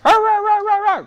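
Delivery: high playful mock barking; cheering dog bark. The cute dog on the sidelines cheers the runner, high barking. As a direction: bark him on like an excited little dog. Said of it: "cheering him on".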